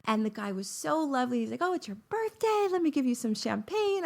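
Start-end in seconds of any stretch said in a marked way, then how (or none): 1.6-4.1 cheery clerk. Retold or imitated. Hotel clerk voiced.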